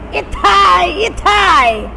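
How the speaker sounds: high shouted "Itai, itai!" in mock pain; acted Japanese ouch. Teacher showing how Japanese cry ouch, shouting. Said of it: "In Japanese, you say".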